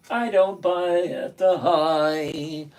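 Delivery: slow, drawn-out words; slow emphatic repeat. Real, not acted. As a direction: spell out a firm rule slowly, word by word. Repeats the rule slowly for emphasis.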